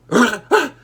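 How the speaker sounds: high, loud gasp; a sudden snapping gasp; sharp gasp. Retold or imitated. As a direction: gasp loudly in outrage. He snaps at cashier.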